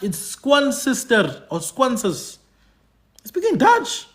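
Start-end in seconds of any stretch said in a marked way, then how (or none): 3.2-4.1 much higher, pressing the word Dutch; emphatic high point. Real, not acted. Telling Xhosa speakers they speak Dutch, high and emphatic. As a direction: drive it home, high.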